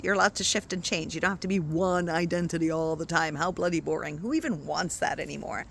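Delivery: normal talking voice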